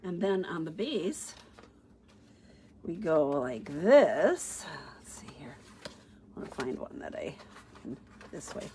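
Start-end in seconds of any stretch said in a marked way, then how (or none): 5.0-8.7 low unvoiced whisper to herself. Real, not acted. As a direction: whisper while searching. Muttering to herself while searching.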